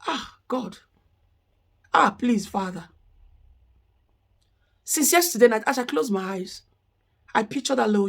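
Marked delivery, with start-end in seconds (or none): 0.1-2.9 a choked cry, trailing much softer into a plea; real crying. Real, not acted. Praying over the dead little child, crying. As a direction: let the grief break through.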